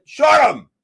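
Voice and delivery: sudden sharp yelled command; shout. Retold or imitated. To the traders, yelling to close shorts. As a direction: bark the order out loud. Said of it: "I went".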